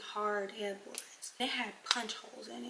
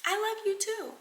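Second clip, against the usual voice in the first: higher and louder gushing reply; overeager; 11 semitones higher, 6 dB louder than usual. Retold or imitated. She gushes back at the boyfriend in high excitement. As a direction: blurt it out over the top. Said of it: "I was like".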